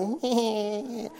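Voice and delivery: weak, soft mock whine; imitated limp yogi. Mocking yogis who eat only green stuff, feeble whine. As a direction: feeble noise of a wet-noodle yogi. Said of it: "and they're like".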